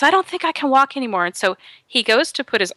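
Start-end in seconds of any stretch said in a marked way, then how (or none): 0.0-1.2 higher, quicker mild distress; retold worried victim. Bitten woman to her boyfriend, mild high-pitched alarm. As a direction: imitate someone realizing they can't go on. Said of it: "She says".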